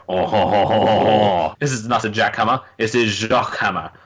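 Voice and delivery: angry French guy laughing; hammy French villain laugh; French accent. Retold or imitated. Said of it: "A really angry French guy".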